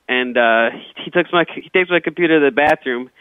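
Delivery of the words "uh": usual speaking voice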